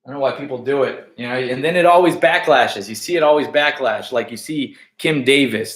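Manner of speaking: ordinary talk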